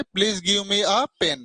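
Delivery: "Please give me a pen" spelled out very slowly; slow, careful classroom example; polite request example. Shown as a demonstration. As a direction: dictate it word by word as a sample request. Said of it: "जैसे".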